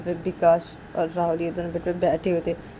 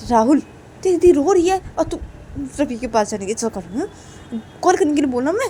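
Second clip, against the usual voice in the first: much higher, much louder furious scolding; angry scolding; 11 semitones higher, 6 dB louder than usual. Acted. Vikas scolds Rahul for wanting to run to Ravi, loud and angry. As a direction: tear into him for being foolish. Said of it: "तो विकास".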